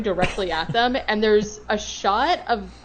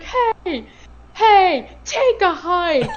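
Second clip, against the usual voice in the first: higher, louder imitated shout, slow at the end; mock yell; 10 semitones higher, 7 dB louder, much slower than usual. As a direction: shoo him off with a hammed-up yell. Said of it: "He's like".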